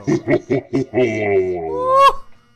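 loud shaky laughter of fright; nervous laugh. Real, not acted. Player laughing nervously after a scare, loud nervous laugh. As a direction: laugh off the terror.

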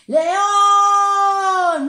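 grito agudo y alargado; llámalo a voces con los niños; llamada a gritos. Acted. Llamando al león. (los animales llaman al león a gritos)

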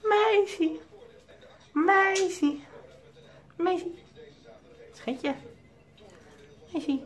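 very high sing-song calling with falling ends. Real, not acted. Owner calling his parakeet Meisje, high coaxing sing-song. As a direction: coo the bird's name up high to win its attention.

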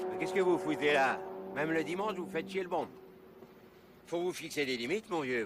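loud, irritated snapping back; angry retort. Acted. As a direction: bark it back at him. Man snaps back at intruder. (réplique agacée et forte)

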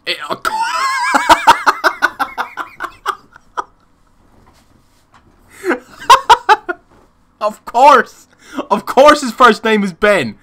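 loud, high cry through real laughter; laughing shout. Real, not acted. Gamer finding out the joke name is real, laughing shout. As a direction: crack up while shouting it.

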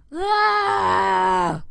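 drawn-out high yell; long loud scream. Pretend. Yell capping the jump chant.

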